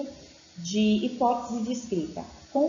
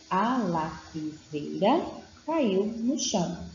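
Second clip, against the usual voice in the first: "A lapiseira caiu no chão" read slowly in separated syllables; much slower than usual. Shown as a demonstration. Teacher reading out the child's sentence, "A lapiseira caiu no chão" slow and syllabic. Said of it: "a leitura é feita por sílabas".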